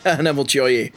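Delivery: lightly voiced villain exclaiming; a hint of a sly nobleman greeting; mild mock exclamation. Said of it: "s'exclama-t-il".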